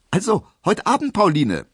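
a hasty happy farewell call. A narrator's reading. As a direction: call back to her while hurrying off. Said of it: "rief er".

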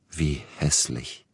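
slow, sorrowful murmur; troubled. A narrator's reading. Agent hurt by composer's self-deprecation, troubled. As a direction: draw it out with concern. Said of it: "machte Neugebauer bekümmert".

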